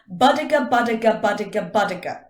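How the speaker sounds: rapid-fire BUDIGA, loud and rhythmic; fast, punchy articulation drill; quick repetition. Shown as a demonstration. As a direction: rattle off the syllables, high start stepping down. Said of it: "say it quickly four times".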